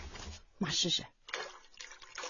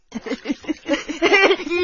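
a warm, happy bubbling laugh; mother's fond laugh while scrubbing her son's feet; delighted laugh; 11 semitones higher, 7 dB louder than usual. Acted. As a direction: laugh with pleasure at your son.

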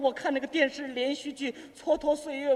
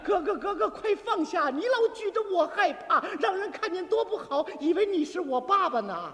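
higher-pitched, rhythmic pleading verse; recited comic poem; 4 semitones higher than usual. Acted. Younger brother pleads with brother holding duster, high recital. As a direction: chant it as an improvised plea in verse. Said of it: "怎么念的".

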